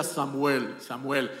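low, deep call of the name Samuel; God's voice calling. Acted. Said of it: "una voz que le decía".

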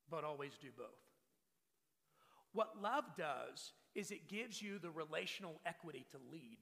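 normal talking voice

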